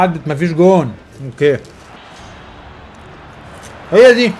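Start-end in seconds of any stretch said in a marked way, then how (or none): 3.9-4.3 a sudden high, loud yell; excited yell. Real, not acted. Fan-manager yelling at the screen as a chance comes, excited. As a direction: burst out at the big chance. Goal chance in live match.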